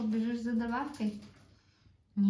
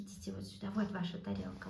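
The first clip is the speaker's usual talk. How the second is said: ordinary delivery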